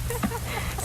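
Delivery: quick high burst of laughter; short laugh. Real, not acted. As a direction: laugh it off lightly. Laughing about forgotten umbrellas.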